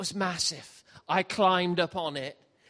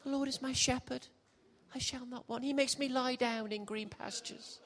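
dreamy naive believer; 8 semitones higher, 6 dB quieter than usual. Retold or imitated. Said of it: "And we think".

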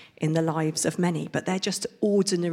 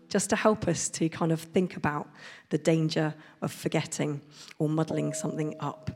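ordinary talk

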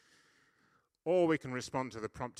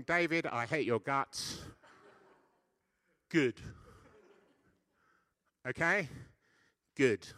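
loud challenge; 3 semitones higher, 8 dB louder, slower than usual. Real, not acted. Preacher provoking the congregation.

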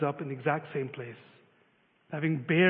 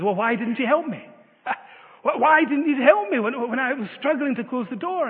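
high, louder, half-laughing exasperation; exasperated complaint; 8 semitones higher, 6 dB louder than usual. Retold or imitated. Battered man to Jesus, exasperated, half-laughing. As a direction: play the bruised man demanding answers.

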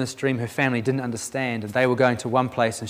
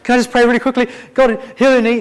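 higher and louder, rushing a prayer before the bus leaves; quick urgent prayer; 10 semitones higher, 7 dB louder, faster than usual. Retold or imitated. Praying for a girl before her bus leaves, quick and urgent. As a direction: squeeze in a hurried prayer, voice up high.

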